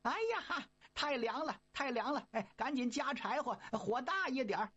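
high, cheeky monkey yelling from the furnace; monkey calling out. Acted. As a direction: call out high like a mischievous monkey. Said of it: "还直喊呢".

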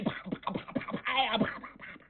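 quick breathy smacks, mostly unvoiced; slapping blow sounds. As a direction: tap out each hit like a blow landing. Imitated blows striking soldiers.

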